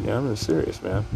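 usual speaking voice

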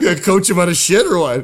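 loud joking disbelief; incredulous. Real, not acted. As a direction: toss out the absurd question, laughing at it.

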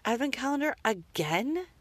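mother scolding son over eaten advent chocolate, exasperated; exasperated disbelief. Retold or imitated. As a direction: catch him out in disbelief. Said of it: "I was just like".

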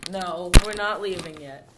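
loud, slow insistence; loud protest. Real, not acted. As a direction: protest loudly.

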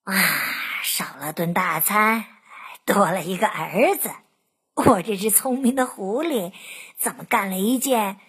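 low, musing fox voice; fox thinking. A narrator's reading. Fox wonders at gaining a son, musing low. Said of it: "狐狸想".

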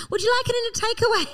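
high, fast waiter voice; mock waiter offering a box; chirpy waiter. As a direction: rattle it off like a bright server. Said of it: "that night I fe".

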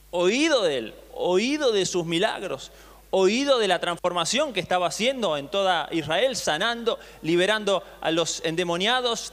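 ordinary talk, nothing marked

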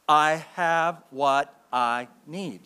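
very slow so the church can follow; call to repeat. Shown as a demonstration. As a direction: lead the congregation word by word. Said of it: "Say it with me, church".

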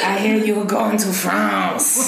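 drawn-out, hammed-up French accent; fake French accent. Shown as a demonstration. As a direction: ham it up as a Frenchman, French accent through a giggle. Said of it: "my fake French accent".

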